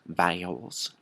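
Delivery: "vowels" stretched into extra syllables, Texas style; "vowels" with a broad Southern diphthong; Texan drawled vowels. Shown as a demonstration. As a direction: drawl "vowels" as vay-uls. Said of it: "adding extra syllables in your vowels".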